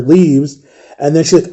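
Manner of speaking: ordinary delivery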